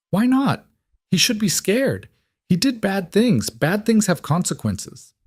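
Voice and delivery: put-on high blunt child's voice; a stern little boy, high; young boy voice. A narrator's reading. Voices nine-year-old son.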